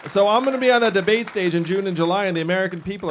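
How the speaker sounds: a candidate firing up the room; rousing rally boast. Real, not acted. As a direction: sell it to the crowd. Rally boast building to applause.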